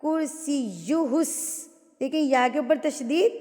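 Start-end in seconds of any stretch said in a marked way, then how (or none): 0.0-1.2 کرسیہ slowly, leaning on "yy"; pressed doubled consonants. Shown as a demonstration. Showing students tashdeed, کرسیہ with pressed "yy". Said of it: "زور دے کے پڑھنا ہے".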